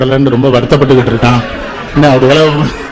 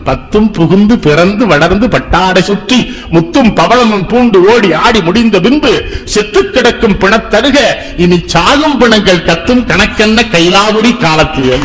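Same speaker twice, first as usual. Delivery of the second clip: put-on slow, measured recital of old verse; verse recitation; 5 semitones higher than usual. Speaker quotes a saint weeping over the dead, slow rhythmic recitation. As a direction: declaim it rhythmically, drawing out the words.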